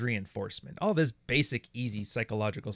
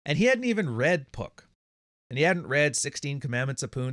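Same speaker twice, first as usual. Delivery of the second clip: normal talking voice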